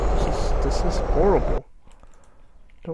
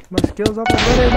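higher and louder, pressing urgency; urgent repeat; 7 semitones higher, 7 dB louder than usual. Real, not acted. Gamer under attack, repeating it urgently and louder. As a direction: say it again, now anxious.

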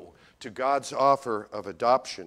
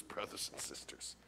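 whisper; 7 semitones lower, 22 dB quieter than usual. Acted. Preacher whispers to congregation.

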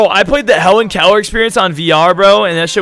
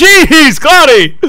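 exasperated shout; 10 semitones higher, 9 dB louder, much slower than usual. Real, not acted. Reacting to chat spam.

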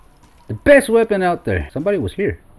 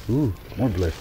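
ordinary talk, nothing marked

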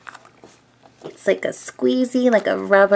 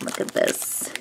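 whispering; 10 dB quieter than usual. Real, not acted. Squishy review, whispering close.

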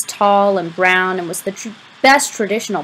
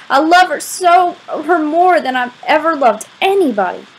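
high, overwrought declaration of love; dramatic, tearful; 7 semitones higher, 5 dB louder than usual. A narrator's reading. Crying boy declaring his love to his friend, dramatic. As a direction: pour it out melodramatically, pitched up. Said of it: "I was quite the dramatic twelve-year-old".

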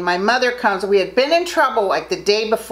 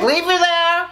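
gruff dad; 8 semitones higher than usual. Retold or imitated. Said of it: "He said".

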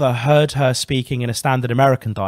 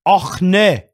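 a light, raised mock Scottish Och; 5 semitones higher, 4 dB louder than usual. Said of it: "That's a Scottish accent".

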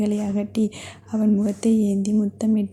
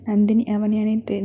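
plain reading voice

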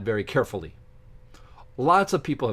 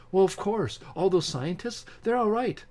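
a light, higher-pitched mock of a smug expert; mocking Neil deGrasse Tyson; 8 semitones higher than usual. Mimicking Tyson brushing off critics, light higher mock. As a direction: lightly parody his easy dismissal. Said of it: "He says".

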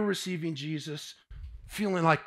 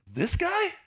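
much higher, mock disbelief; mocking coach's disbelief; incredulous. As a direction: scoff at the skinny kid. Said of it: "He's like".

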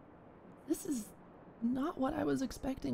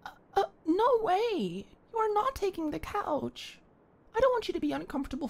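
flustered protest; 5 semitones higher, 4 dB louder than usual. Acted. Refusing to take the host's couch, flustered loud protest.

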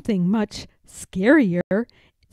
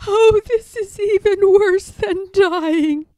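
weepy ghost; 10 semitones higher, 4 dB louder than usual. A narrator's reading. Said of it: "The ghost broke down and started crying".

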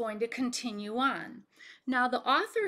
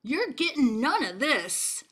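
mocking taunt; 3 semitones higher, 4 dB louder than usual. Pizza eater taunting Bullfrog, loud and gloating. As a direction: rub it in his face.

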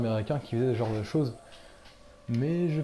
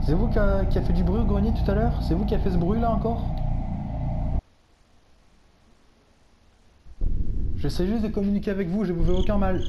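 calling to a spirit; 7 semitones higher, 6 dB louder than usual. Real, not acted. Introducing himself loudly to the spirit. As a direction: announce yourself to something unseen.

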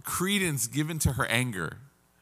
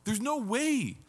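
high-pitched disbelief; acted-out amazement; astonished listener; 9 semitones higher, 4 dB quieter, slower than usual. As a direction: say it as if you cannot believe it. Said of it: "you're like".

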